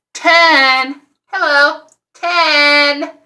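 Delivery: outraged, incredulous count; incredulous emphasis. Real, not acted. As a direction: hammer the number in disbelief. Disbelief at ulcers.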